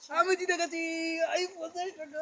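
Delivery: excited victory. Real, not acted. Claims the prize money.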